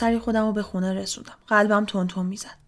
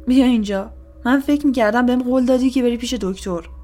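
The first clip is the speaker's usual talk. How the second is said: loud scolding; 3 semitones higher, 6 dB louder than usual. A narrator's reading. Bald policeman scolds the woman.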